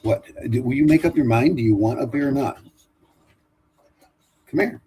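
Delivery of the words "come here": high dog call. Real, not acted. Calling the dog again.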